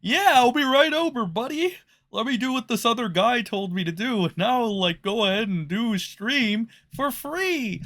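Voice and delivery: high-pitched mocking eager yes-man; Ethan Ralph. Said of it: "Ethan Ralph says".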